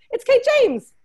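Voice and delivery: high, loud hype announcement; excited introduction. Real, not acted. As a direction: cheer the guest's name out. Host announces the next guest.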